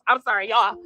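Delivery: ordinary delivery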